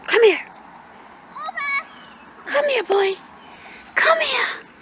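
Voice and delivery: high, loud coaxing calls to the dog; high pet voice. Real, not acted. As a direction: coax the dog to come over. Calling the dog over.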